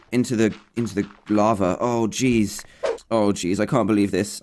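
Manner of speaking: ordinary talk, nothing marked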